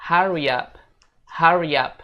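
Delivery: teacher's model "hurry up"; pronunciation model. Shown as a demonstration. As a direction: lead learners through "hurry up", linked. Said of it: "teman-teman bisa ikutin aku".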